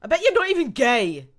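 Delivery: high, very loud accusation; indignant outburst. Acted. Ashley lashing out at Krauser, high and loud. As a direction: fling it at your betrayer.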